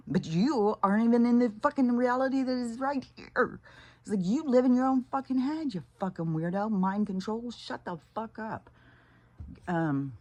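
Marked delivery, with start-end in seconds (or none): 0.1-8.7 mocking rant. Real, not acted. Telling off online commenters.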